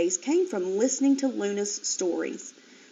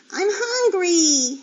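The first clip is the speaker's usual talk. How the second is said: high, slow whiny voice; whine; 9 semitones higher, 5 dB louder, slower than usual. A narrator's reading. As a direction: whine it like a hungry piglet. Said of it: "he whined".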